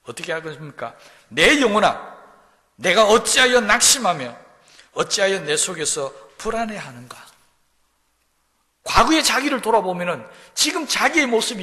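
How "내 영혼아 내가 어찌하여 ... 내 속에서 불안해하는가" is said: loud, higher cry to his own soul; a preacher voicing the psalmist scolding himself; lamenting rebuke. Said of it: "자기가 탄식하면서 꾸짖습니다".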